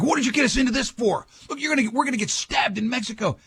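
high, quick frantic complaint; buddy trapped in a hotel room; panicked buddy. Retold or imitated. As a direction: freak out at your friend. Panicked buddy behind hotel door.

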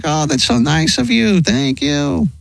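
high, sweet mock-polite thanks; grateful repairman. Repairman gushing thanks to the wife, high and sugary. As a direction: gush thanks like the flustered repair guy.

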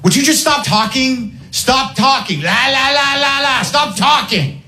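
high, mocking impression of the girlfriend; girlfriend's lines. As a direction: squeal it like a nagging partner. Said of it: "Let's enter the fight with her lines".

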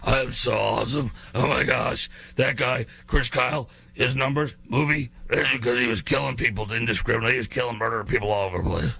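a hint of a smug gravelly braggart, fast; mocking Graham Plattner. Host mocking Plattner's bragging, lightly voiced and fast. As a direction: sneer his words back at him. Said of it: "in your gravelly voice".

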